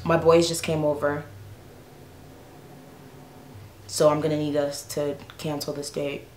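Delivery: low, quiet, halting mock excuse; lightly voiced guy backing out, with long pauses; imitating her date. Date calling off their plans, sheepish imitation. Quoting the date's casual excuse.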